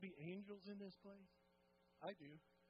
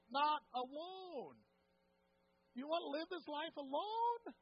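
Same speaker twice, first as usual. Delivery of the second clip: preaching at full force; 7 semitones higher, 9 dB louder than usual. Acted. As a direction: drive it home to the congregation.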